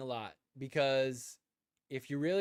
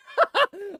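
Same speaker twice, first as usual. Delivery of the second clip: loud laugh; 11 dB louder than usual. Real, not acted. Laughing at own story.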